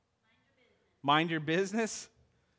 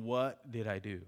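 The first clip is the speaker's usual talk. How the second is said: lower, soft groggy mumble; hungover groan; 7 semitones lower, 8 dB quieter than usual. Retold or imitated. Pastor imitates someone waking hungover, low and groggy. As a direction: sound like a miserable hangover. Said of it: "you wake up the next day going".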